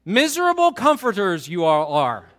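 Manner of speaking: loud, lightly voiced Yoda line; half-played Yoda, projected loudly; Yoda moment. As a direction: proclaim it with a hint of Yoda. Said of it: "This is a Yoda moment".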